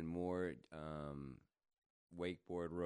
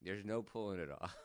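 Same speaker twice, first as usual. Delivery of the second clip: ordinary talk, nothing marked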